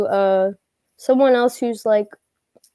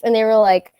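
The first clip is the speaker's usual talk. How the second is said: normal talking voice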